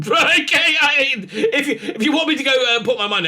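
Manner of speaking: laughing through the words. Real, not acted. Host laughing at his guess about police, laughing. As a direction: chuckle as you go, amused at your own cynicism.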